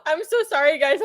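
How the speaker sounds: usual speaking voice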